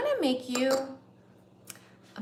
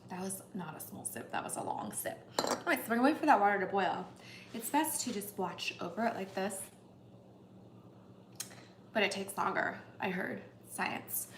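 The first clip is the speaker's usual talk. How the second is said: ordinary delivery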